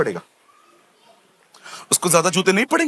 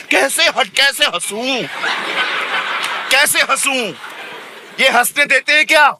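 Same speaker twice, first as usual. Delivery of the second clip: mock complaining aunt; 7 semitones higher than usual. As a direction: play the aunt who can't smile. Aunt complaining she can't laugh.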